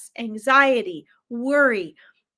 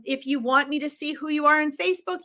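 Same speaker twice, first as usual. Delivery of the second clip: usual speaking voice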